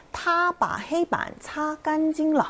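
slow, high Chinese model reading of 타바헤이반, 차간징러; 중국어 예문 낭독. Shown as a demonstration. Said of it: "그가 칠판을 깨끗하게 닦았다라는 뜻이죠". (타바헤이반, 차간징러를 중국어 성조로 높고 느리게 낭독)

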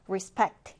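respect with the weight on the second syllable SPECT; stress on SPECT. Shown as a demonstration. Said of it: "두 번째 음절에 강세를 두고".

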